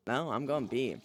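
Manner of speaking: ordinary talk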